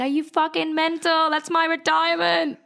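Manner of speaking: high-pitched mock outrage as dad; shocked dad. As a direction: squeak it like a panicking father. Father's shocked outburst retold.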